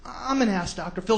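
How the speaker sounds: soft, unconvinced mock confession; half-hearted. Guest obeying TV host, soft and half-hearted. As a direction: mutter it meekly like a reluctant guest. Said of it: "Say it like you mean it".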